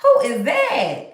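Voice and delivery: slow, drawn-out wondering hello; impressed double take. Pretend. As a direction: act stunned as they walk in. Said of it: "they be like".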